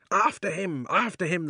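high, loud hunting cry; excited shouting. Acted. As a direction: call out the chase. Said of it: "they shouted".

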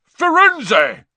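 outraged high, loud roar; loud centaur bellow; thundering shout. A narrator's reading. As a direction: thunder the name in fury. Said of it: "Bane thundered".

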